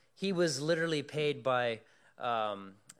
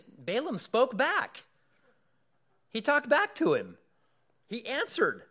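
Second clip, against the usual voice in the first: high, loud astonishment; amazed exclamation; 11 semitones higher, 8 dB louder than usual. Real, not acted. Preacher marveling to congregation that Balaam answered, high and loud.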